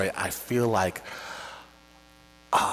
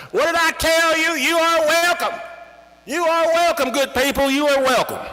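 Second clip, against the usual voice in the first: much higher and louder, grandly welcoming; proud father; 8 dB louder than usual. Retold or imitated. Father calling out to the grateful farmers, loud and high. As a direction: boom it out like a proud host.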